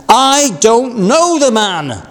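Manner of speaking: sworn denial. A narrator's reading. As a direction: Peter swears to the bystanders, high and slow. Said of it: "he swore to them".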